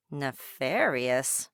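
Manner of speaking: turning the odd word Nefarious over slowly; puzzled wondering. A narrator's reading. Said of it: "she wondered".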